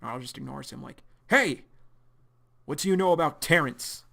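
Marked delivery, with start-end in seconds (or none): 1.3-4.0 loud, higher demand; confronting shout. Acted. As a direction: call him out and demand an answer. John confronts Arlo.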